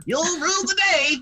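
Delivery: high, fast hammy villain; mock cartoon villain boast. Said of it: "a villain that just goes".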